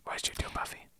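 whisper. Retold or imitated. Echoing the eerie whisper.

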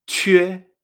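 chue said "chüe", with French U; loud, clear chue with Ü before E; Q con diéresis. Shown as a demonstration. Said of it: "con la Q". (chue con U francesa)

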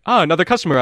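high, loud welcome from a genteel goblin; grand goblin shopkeeper; regal goblin, greeting. Acted. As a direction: greet the customer with lofty delight. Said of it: "he's like".

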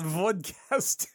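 mock Russian accent on Vodcast. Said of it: "say it in a Russian accent".

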